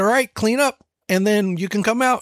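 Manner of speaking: lightly acted cheery instruction to a toddler; upbeat parent. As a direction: chipper mock parent voice. Dad repeats instruction to daughter.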